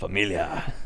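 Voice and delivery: low mock tough-guy catchphrase; jokey low impression of an action star; Vin Diesel. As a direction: lightly do a low action-hero voice. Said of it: "Vin Diesel gonna be Vin Diesel".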